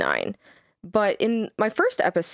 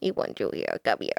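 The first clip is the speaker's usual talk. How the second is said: low creepy creature croak; 13 semitones lower, 5 dB quieter, faster than usual. Pretend.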